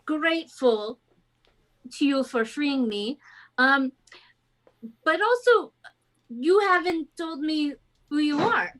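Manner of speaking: put-on halting French-accented captain; bad French accent. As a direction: thank them haltingly in a clumsy French accent. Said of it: "the worst French accent to ever exist".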